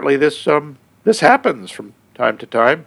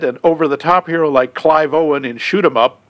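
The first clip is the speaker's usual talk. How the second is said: ordinary talk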